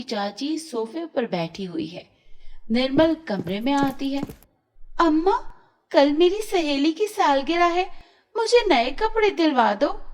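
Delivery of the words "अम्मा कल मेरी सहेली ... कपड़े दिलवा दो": put-on higher, louder pleading girl; spoilt daughter. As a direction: whine for new clothes like a spoilt child. Nirmal begs mother for clothes.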